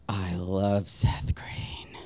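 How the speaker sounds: very slow, hushed mock confession; creepy whisper. Bar stranger confessing to a lookalike, creepy whisper. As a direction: lean in and whisper it, drawn right out.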